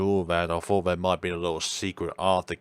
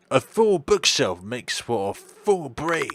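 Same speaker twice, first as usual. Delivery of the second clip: slow, higher, louder pronouncement; doctor proclaiming a proverb, slow and raised; doctor character voice; 5 semitones higher, 4 dB louder, slower than usual. Acted. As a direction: deliver it like a wise old doctor, slowly. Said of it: "I'm gonna drop this voice".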